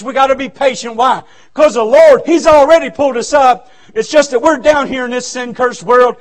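ordinary delivery

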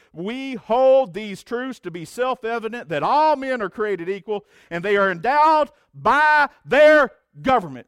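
loud, high, slow declamation, "government" swelling to a peak; solemn recitation. Acted. Pastor reciting the Declaration to his church, grand. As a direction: recite it like a founding charter. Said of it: "the Declaration of Independence that says this".

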